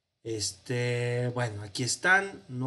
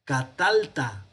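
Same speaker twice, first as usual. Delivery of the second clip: Catalta stretched out slowly, a bit louder; slow demo form; 4 dB louder, slower than usual. Shown as a demonstration. Teacher showing the contracted form Catalta, slow and clear. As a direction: pronounce Catalta slowly for the class. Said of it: "aquí es donde se da la contracción".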